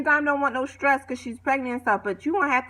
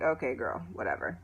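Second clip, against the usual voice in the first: much lower and softer, trailing into a whisper; dismissive whisper; 12 semitones lower, 11 dB quieter than usual. Retold or imitated. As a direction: brush her off under your breath. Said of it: "So I'm like".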